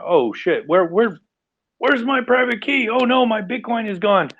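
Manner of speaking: high-pitched mock panic, voice shooting up. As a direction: act out the sudden dread of losing the key. Acting out panic over lost key.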